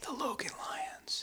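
hushed, breathy dramatic whisper. Acted. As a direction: breathe the name like a secret.

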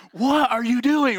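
high, loud, drawn-out scolding; high-pitched, stretched-out disbelief; exasperated wife. Retold or imitated. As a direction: imitate an exasperated wife's protest. Imitates wife's scolding once more.

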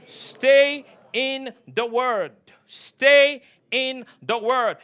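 "Stay" stretched long and forceful, then a gap; emphatic exhortation. Real, not acted. Preacher urging congregation to stay in the Word, slow emphatic "Stay". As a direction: slowly hammer out "Stay in the Word".

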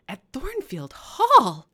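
slow, loud, high amazed cry; astonished exclamation. Acted. Clergyman astonished at Mason's claim, loud high cry. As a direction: blurt it in disbelief. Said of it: "ejaculated the clergyman".

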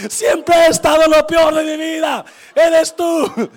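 loud, high accusing yell through laughter; mock-furious dying husband; comic shout. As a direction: belt the punchline accusation. Punchline: you're bad luck.

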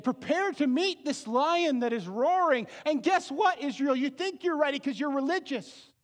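loud, high fervent preaching; preaching at full force. Acted. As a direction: drive the warning home to the congregation. Preaches to the congregation.

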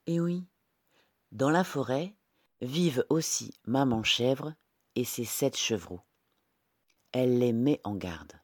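ordinary delivery, nothing marked

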